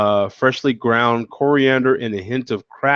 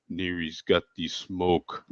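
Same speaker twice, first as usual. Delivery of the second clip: lightly put-on Russian accent, lower and slow; 6 semitones lower, 10 dB quieter, slower than usual. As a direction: imitate a Russian guy, slow and low. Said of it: "like that a Russian accent".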